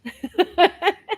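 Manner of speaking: loud, high burst of laughter; real laugh. Real, not acted. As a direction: laugh out loud with your partner. Laughing with Lorna about pause.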